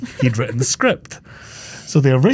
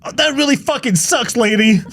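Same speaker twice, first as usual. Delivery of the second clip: much higher put-on voice, sneering; mock asshole guy; 11 semitones higher than usual. Mocking the guy who loses the diamond, high and sneering. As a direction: sneer it in a silly high cartoon voice. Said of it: "He goes".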